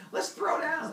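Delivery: high, taunting challenge; cocky hare. Retold or imitated. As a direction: puff up like a bully hare picking a fight. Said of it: "And the hare's like".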